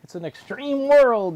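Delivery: "extreme" stretched high and loud; stress on "extreme". Real, not acted. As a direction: blow up the word "extreme". Playful emphasis on extreme world.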